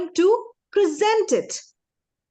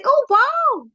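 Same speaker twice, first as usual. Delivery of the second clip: much higher, louder, fast and beaming; excited, smiling; 8 semitones higher, 4 dB louder than usual. Shown as a demonstration. Teacher showing students how a smile lifts the voice. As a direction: burst out with a big smile. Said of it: "the moment I change my facial expression".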